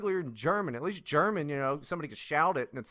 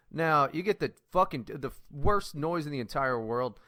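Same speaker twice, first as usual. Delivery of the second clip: ordinary talk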